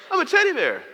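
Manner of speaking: very high, loud comic squeal; squeaky punchline. Acted. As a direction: squeal it like a toy. Punchline as his daughter's toy.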